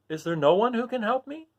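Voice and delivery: put-on high, earnest girl voice; a child pleading; earnest Dorothy. Dorothy earnestly asking for help. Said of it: "asked Dorothy earnestly".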